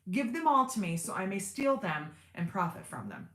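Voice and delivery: ordinary delivery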